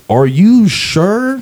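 very drawn-out, high incredulous question. Retold or imitated. Comic questions handler and owner, incredulous. Doubting the owner's request again.

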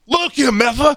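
high, sudden mock outburst; angry translator. Key's translator snapping at critics, high mock outburst. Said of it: "And the key was like".

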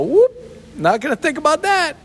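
high, breezy brush-off; carefree dismissal. Retold or imitated. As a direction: toss it off lightly. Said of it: "just go".

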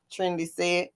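ordinary delivery, nothing marked

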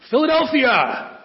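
shouted-out reprimand, played for laughs; mock dad shout. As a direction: imitate an exasperated dad calling from the driver's seat. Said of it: "would often shout loudly".

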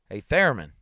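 loud call across the room; sharp loud hail. Acted. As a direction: shout his name to get his attention. Calling out to the columnist.

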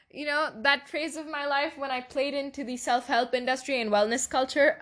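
ordinary talk

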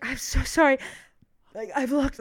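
acting winded after a sprint; mock breathless pleading; out of breath. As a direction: imitate panting through an apology. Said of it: "I'm, like, so out of breath".

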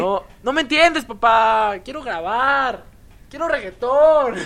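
high, loud, drawn-out pleading; whiny daughter. Acted. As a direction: beg dad like a spoiled girl. Acting whiny daughter begging dad.

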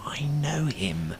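hushed whisper to his partner. Retold or imitated. Whispering to Sam about the cafe owner. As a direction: whisper so the owner can't hear.

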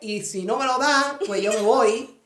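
hammed-up Cuban street accent. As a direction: friend playing a loud Cuban accent. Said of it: "Eso es el acento".

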